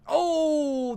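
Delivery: high loud excited oh. Real, not acted. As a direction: burst out excited. Excited exclamation.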